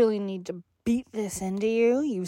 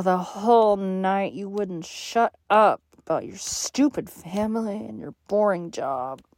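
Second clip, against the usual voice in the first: ordinary delivery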